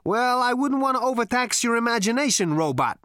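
high-pitched sneering comeback; relieved sneer. A narrator's reading. Zaphod mocks the robot, with a relieved sneer. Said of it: "in a kind of relieved sneer".

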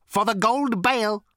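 high, loud robot rasp. A narrator's reading. Robot states its mission to Zaphod, rasping. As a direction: rasp it out like a lifeless machine. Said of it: "rasped the robot".

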